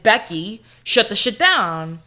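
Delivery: loud, slow, emphatic command; forceful retold demand; retold firm order. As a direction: act out laying down the law, word by word. Acting out order to bank teller.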